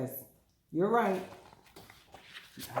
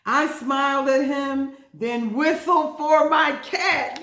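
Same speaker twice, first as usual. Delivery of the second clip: slow, louder, higher storybook reading; dramatic reading; 7 semitones higher, 8 dB louder, slower than usual. A narrator's reading. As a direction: read it out slow and proud.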